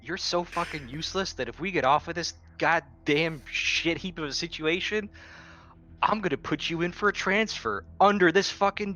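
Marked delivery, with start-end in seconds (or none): none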